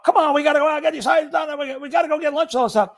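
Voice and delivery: high, hurried-along mock hollering; impatient urging. Crew pushing to get hydrants done before lunch, impatient.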